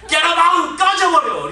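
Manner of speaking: high, loud angry yell; imitating a drunk father roaring at his son; drunk father's outburst. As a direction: throw him out with a shout.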